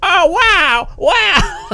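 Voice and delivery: loud, high-pitched gushing wows; hammed-up tourist awe, shouted high; mock amazement. Mocking the awed American tourists.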